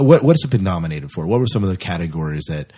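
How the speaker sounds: ordinary talk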